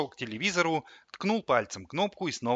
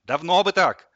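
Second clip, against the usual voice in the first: high, loud told-you-so; triumphant; 7 semitones higher, 11 dB louder than usual. A narrator's reading. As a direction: crow it loudly. Boy satisfied as fridge gives in. (громко, с торжеством)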